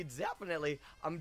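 usual speaking voice

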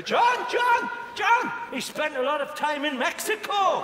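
mock interruption. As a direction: cut in high and insistent.

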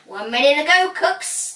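announcing. A narrator's reading. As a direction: call time to the contestants. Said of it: "announced Tony Truffle".

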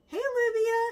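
high, loud, bright hello to a viewer; excited shout-out. Real, not acted. Vlogger greets viewer Olivia by name, excited high call-out. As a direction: call out her name with a big grin.

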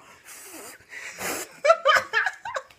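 loud laughter. Real, not acted. Laughs at own punchline.

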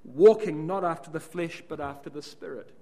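"walking" punched hard and loud, the rest falling away; stress on walking. Shown as a demonstration. Preacher showing the congregation the shifted emphasis, "walking" punched loud. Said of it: "the emphasis all of a sudden becomes".

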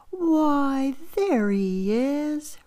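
spring the surprise slowly; mock roar of discovery. Father bear finding little bear in the mirror, gleeful. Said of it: "roars the big bear".

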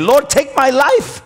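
high, pleading cry to God; desperate plea. Retold or imitated. Elijah under the tree, crying out to God, high desperate plea. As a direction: cry it out like a broken prophet begging to die. Elijah's despairing prayer retold.

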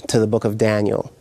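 usual speaking voice

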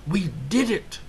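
high, thrilled cry of victory; excited cry. Acted. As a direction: cheer it out. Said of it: "cried Toad".

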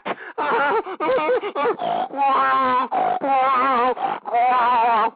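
loud, long derisive laugh; a long scornful laugh at his own impression; mocking laugh. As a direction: cackle at the fools.

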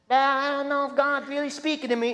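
high, whiny doubting voice; mock the unsure believer; whiny doubter.

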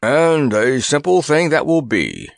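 sarcastic grunt. A narrator's reading. Kabumpo scoffing at crossing the deadly desert, sarcastically. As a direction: pour sarcasm over every word. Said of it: "grunted the Elegant Elephant sarcastically".

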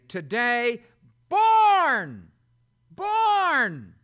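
"born" repeated high and loud, each falling hard; stress on born. Shown as a demonstration. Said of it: "And it actually would say".

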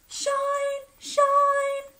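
very high, sing-song call; sun shining. A narrator's reading. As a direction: sing it out brightly like sunshine. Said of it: "went the sun".

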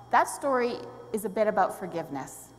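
normal talking voice

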